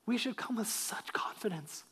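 ordinary talk

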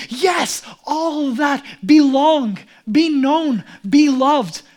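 loud, drawn-out exultation; exultant cry. Acted. Preacher celebrates belonging and being loved, exultant. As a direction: proclaim each phrase with rising force. Celebrating gospel fruit at full force.

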